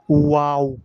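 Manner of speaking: ওয়াও with lips rounded into a circle after the sound; rounded-lip waw. Shown as a demonstration. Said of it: "ওয়াও এভাবে বলতে হবে".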